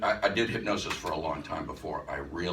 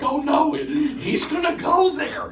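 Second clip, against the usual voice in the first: mock-horrified high shout from the crowd, much higher and louder; shocked audience gasp; 12 dB louder, slower than usual. Teacher imitating his shocked class, high and loud. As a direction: squeal it like a whole audience that can't believe it. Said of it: "there was this gasp, of people like".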